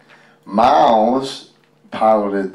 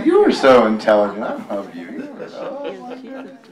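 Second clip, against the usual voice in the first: gushing praise; 4 semitones higher than usual. Real, not acted. Proud dad cooing at his son in a much higher voice. Gushing over the child's answer.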